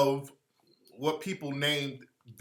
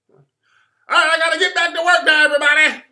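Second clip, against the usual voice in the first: high, very loud shouted hype-man voice; screaming crispy character voice; crispy voice yell; 12 semitones higher, 14 dB louder than usual. Retold or imitated. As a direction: bellow it like a hyped-up character who won't quit. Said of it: "stayed in Crispy character".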